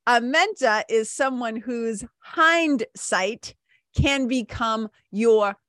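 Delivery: very slow, weighty reading aloud; slow deliberate reading. Shown as a demonstration. Host reading the fortune cookie slowly to listeners. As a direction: read it out slowly, letting each phrase land. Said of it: "I'll say it again".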